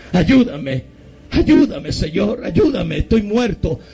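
high, pleading re-enacted cries; desperate cry to God. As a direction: implore heaven, voice leaping upward. Acted crying to God for help.

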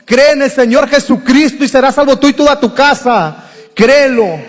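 loud, high altar call hammered home; full-force preaching. Real, not acted. Preacher urging the congregation to believe, shouting high. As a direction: preach it hard, pleading with the congregation.

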